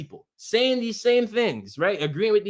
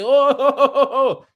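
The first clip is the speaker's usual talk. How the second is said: higher, louder string of mocking ohs; mock protest; 6 semitones higher, 6 dB louder than usual. Chat critics suddenly objecting once he talks, mocking. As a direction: imitate them objecting with rapid-fire ohs. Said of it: "now y'all wanna say".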